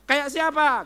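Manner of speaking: loud, high call to the crowd; excited question. Real, not acted. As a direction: fire the question out with energy. Excited rhetorical question to congregation.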